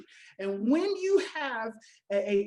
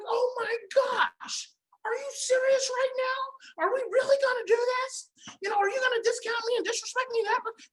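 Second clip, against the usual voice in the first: playact being deeply offended; hurt outcry; 12 semitones higher than usual. Retold or imitated. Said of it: "And you're like".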